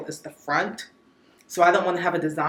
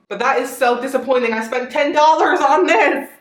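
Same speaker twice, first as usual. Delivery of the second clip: exasperated; 6 semitones higher, 7 dB louder than usual. Real, not acted. LED light not working.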